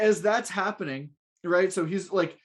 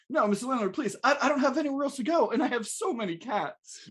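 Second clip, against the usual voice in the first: higher, hammed-up begging; pleading tenant; 7 semitones higher, faster than usual. [name] begging the landlord not to evict, high mock pleading. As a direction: beg to stay as a hapless tenant.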